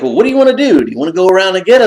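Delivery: quick practical question, lightly mimicked; lightly voiced wife. Wife asking what he wants to do, lightly voiced and rushed. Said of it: "Then she's like".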